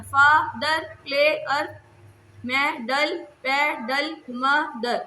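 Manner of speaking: each of "father, player, medal, pedal, मदर" in two separate beats; measured word list, a small gap inside "father, player, medal, pedal, मदर"; split into syllables. Shown as a demonstration. Teacher listing two-syllable words, "father, player, medal, pedal, मदर" each split in two. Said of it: "ये टू syllable words हैं".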